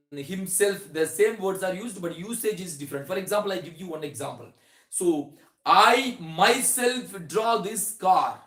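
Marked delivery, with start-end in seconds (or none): 4.9-8.4 "I myself" pushed out slow and loud; slow, loud teacher stress on "I myself"; emphatic stress. Shown as a demonstration. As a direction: lean hard on "I myself" as you say it. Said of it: "when we want to say that emphasizing".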